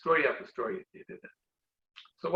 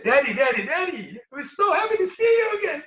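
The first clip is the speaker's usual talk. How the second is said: put-on high, loud, squealing little kids; children crying out with joy as they hug daddy; excited children; 13 semitones higher, 7 dB louder than usual. Children run to hug father.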